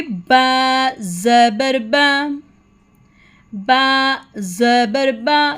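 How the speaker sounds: very slow, drawn-out "बा ज़बर बा"; spelling-out drill. Shown as a demonstration. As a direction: stretch each syllable of "बा ज़बर बा" for learners to follow. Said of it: "मेरे साथ मिलके पढ़िए". ("बा ज़बर बा" बहुत धीरे, खींचकर पढ़ें)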